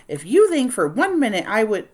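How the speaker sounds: loud, high furious refusal; angry. Acted. Nellie refusing the kids, loud and angry. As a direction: cut them off hard.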